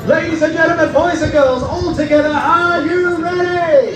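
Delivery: high, stretched hype call; overbright kids party host. Acted. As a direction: rev the crowd up like a kids show host. Crowd hype before stunt.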